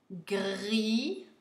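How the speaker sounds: "grimace" slowly split into syllables with pauses. Shown as a demonstration. Teacher dictating to pupils, syllable by syllable. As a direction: break "grimace" into separate, drawn-out syllables.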